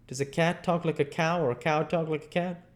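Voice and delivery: lightly voiced boy posing a question; Huck, quizzing. Huck presses Jim on cows and cats, quizzing. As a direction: pose it like a leading question.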